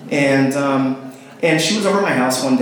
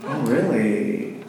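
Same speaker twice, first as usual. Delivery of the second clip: slow, drawn-out interested reply; intrigued; 4 dB quieter, much slower than usual. Retold or imitated. Friend hears she broke up with fiance, slow intrigued reply. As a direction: stretch it out with hidden delight. Retold delight at her breakup.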